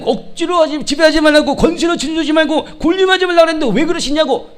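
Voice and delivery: mock a pushy know-it-all telling people off; nagging complaint. Acting out a nagging church member. (따지듯 잔소리하는 흉내)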